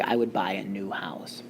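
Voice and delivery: whispered aside. Real, not acted. Teacher translates example softly.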